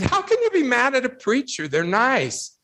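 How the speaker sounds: high-pitched, puzzled protest; incredulous retold reply. Telling a crowd how he questioned the tutor, incredulous.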